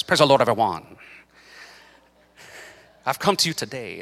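lightly imitated revered preacher; a hint of a solemn guest preacher; Lee Stoneking. Guest preacher greeting the church, lightly imitated. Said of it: "he does a great Lee Stoneking".

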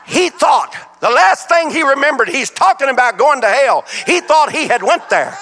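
high, loud storytelling punchline, laughter breaking in; animated punchline. Real, not acted. Preacher delivers the joke about the sleeper, animated punchline.

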